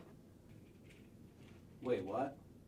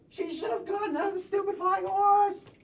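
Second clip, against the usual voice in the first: upset; 8 semitones higher, 8 dB louder than usual. Acted. First take of line.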